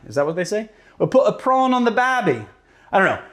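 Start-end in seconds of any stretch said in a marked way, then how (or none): 1.0-2.4 higher-pitched mock Australian accent; attempted Australian accent. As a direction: have another go at Aussie, pitched up. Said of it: "do an Australian accent".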